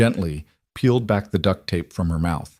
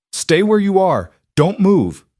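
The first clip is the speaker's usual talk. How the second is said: high, loud, controlled urgency; urgent shout; 10 semitones higher, 4 dB louder than usual. Acted. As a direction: order her to freeze, loud. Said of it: "his voice urgent but controlled".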